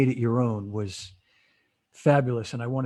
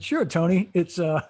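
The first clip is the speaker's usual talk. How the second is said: laughing through words; 5 semitones higher than usual. Retold or imitated. Simon quoted, laughing.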